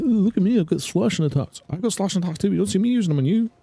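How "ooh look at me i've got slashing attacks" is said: high, taunting mimicry of a braggart; mocking voice. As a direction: sneer it like a show-off. Mocks the enemy's boasting.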